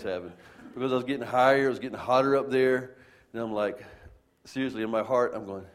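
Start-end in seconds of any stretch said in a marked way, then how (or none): none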